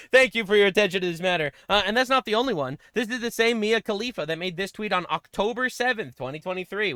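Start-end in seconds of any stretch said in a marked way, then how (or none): none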